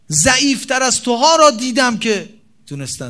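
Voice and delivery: preacher urging a believer loudly; fervent encouragement. Acted. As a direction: lift him up with passion. Fiery encouragement in sermon.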